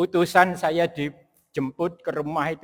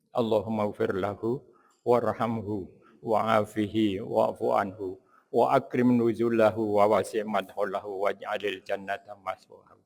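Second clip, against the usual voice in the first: softer, lower murmured funeral prayer; quiet prayer; 6 semitones lower, 7 dB quieter than usual. Acted. Preacher praying for the late doctor, soft low prayer. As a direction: pray gently for the departed soul.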